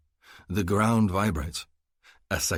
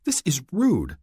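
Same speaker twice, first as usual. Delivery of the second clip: indignant complaint; 10 semitones higher, 5 dB louder than usual. A narrator's reading. Complaining about the language.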